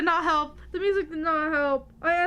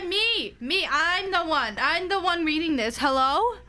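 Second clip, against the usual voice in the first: ordinary talk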